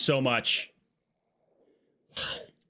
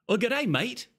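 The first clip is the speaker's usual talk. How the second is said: higher-pitched Australian greeting; Australian accent; 11 semitones higher, slower than usual. Acted. Streamer greeting a viewer, Australian accent. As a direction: greet them as a matey Australian.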